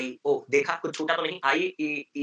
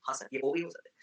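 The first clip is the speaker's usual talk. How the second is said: a soft, small student reply; timid student; 5 semitones lower, 8 dB quieter than usual. Acted. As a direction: answer meekly as the pupil. Student voice answering. (छात्र का धीमा जवाब)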